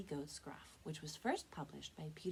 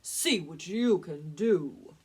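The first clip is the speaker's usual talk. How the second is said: slow, booming boast; big loud goat; 4 semitones higher, 15 dB louder, slower than usual. Acted. Biggest goat defies the troll, slow and booming. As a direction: bellow the challenge, measured and defiant. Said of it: "as loud as the troll's".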